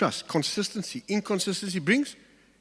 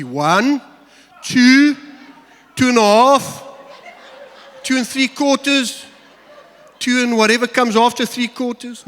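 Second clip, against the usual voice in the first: louder and much higher, stretching the count with a laugh; mock parent countdown; 8 semitones higher, 8 dB louder than usual. Parent mocking an empty countdown to Johnny, hammed-up loud and laughing. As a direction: ham up a parent counting to three.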